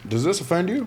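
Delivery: high teasing question; mock interviewer. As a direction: ask it like the guy in the video. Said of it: "he's like".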